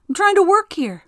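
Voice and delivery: retold yell at the wind. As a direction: shout it up at the wind, fed up. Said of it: "I yelled at the wind".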